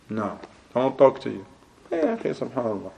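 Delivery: cold brush-off. Retold or imitated. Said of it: "you're not on their club".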